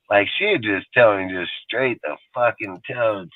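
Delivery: usual speaking voice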